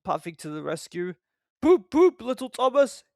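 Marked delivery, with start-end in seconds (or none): 1.6-3.0 high, loud poop poop; whistle toot. A narrator's reading. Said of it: "he whistled".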